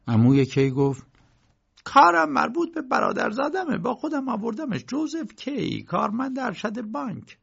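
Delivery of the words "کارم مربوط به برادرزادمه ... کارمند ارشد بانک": high-pitched uncle figure; much higher voice, making an introduction; uncle introducing nephew. A narrator's reading. (عمو با صدای زیر در حال معرفی)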